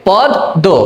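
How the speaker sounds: পদ দো with the দ doubled across the syllable break; the teacher models the doubled দ for students; doubled pronunciation. Shown as a demonstration. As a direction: split পদ দো clearly into two syllables. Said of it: "তাহলে উচ্চারণটা কি হবে?".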